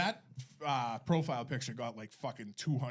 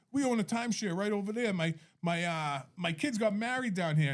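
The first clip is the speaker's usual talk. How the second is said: higher-pitched Rhode Island local; Rhode Island accent; 6 semitones higher than usual. Retold or imitated. Providence man tells a stranger about his timeshare, Rhode Island accent. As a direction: mimic a chatty Providence tourist's accent. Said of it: "that's how he talks".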